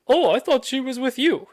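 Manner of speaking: high, loud imitation of a clueless onlooker; mock surprised bystander. Bystanders excuse themselves to the car's owner, mock surprise.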